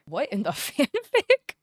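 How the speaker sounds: slow, laughing "what in the fanfic"; laughing disbelief. Real, not acted. Vlogger laughing at a sappy AI comic, incredulous. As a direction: laugh it out, baffled.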